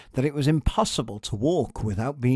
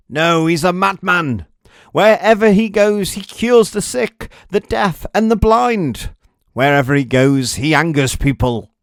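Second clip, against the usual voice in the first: loud, high and worked up; excited bystander; 7 semitones higher, 10 dB louder than usual. Acted. A bystander in the crowd arguing about Jesus, loud and worked up. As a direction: insist on it over everyone.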